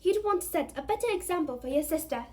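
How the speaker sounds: high, loud motherly scolding; sharp, irritated rebuke; snapped. A narrator's reading. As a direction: snap it at a cheeky son. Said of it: "snapped Missus Weasley".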